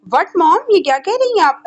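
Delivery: shocked. A narrator's reading. Son hearing his mother fixed his marriage, shocked. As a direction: cry out in shocked disbelief. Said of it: "परेशानी से बोला".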